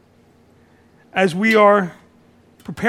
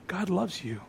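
tender refrain; 11 dB quieter than usual. Acted.

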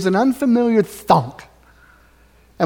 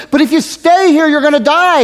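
pleading miner; 8 semitones higher, 7 dB louder than usual. Retold or imitated. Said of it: "He says".

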